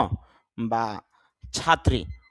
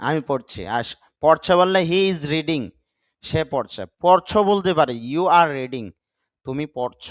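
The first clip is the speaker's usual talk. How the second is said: ordinary talk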